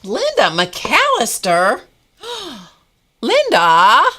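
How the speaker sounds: high, loud, drawn-out call of a friend's name; delighted greeting. Real, not acted. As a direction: sing her name out in delight. Greeting a returning live viewer.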